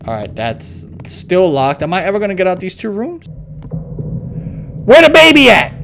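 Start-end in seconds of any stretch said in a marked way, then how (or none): 3.7-5.7 high, drawn-out call; panicked parent calling out; alarmed shout. Pretend. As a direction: shout in alarm for a missing baby. Parent finds baby missing.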